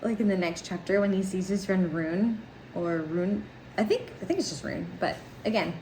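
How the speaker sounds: ordinary delivery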